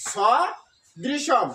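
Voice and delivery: সদৃশম very slow, with a gap after স; teacher repeating সদৃশম in separated syllables; pronunciation demo. Shown as a demonstration. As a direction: hold the first syllable of সদৃশম, then complete it. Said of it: "উচ্চারণ কি হলো?".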